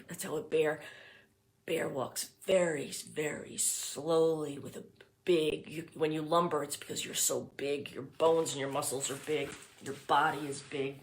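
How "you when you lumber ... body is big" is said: ordinary delivery, nothing marked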